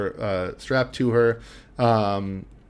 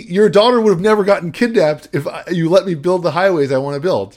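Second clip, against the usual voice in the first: loud, raised-pitch villain's rant; hammed-up villain outburst; 7 semitones higher, 7 dB louder than usual. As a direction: plead the case loudly, like an exasperated villain. Villain's exasperated speech in retold scene.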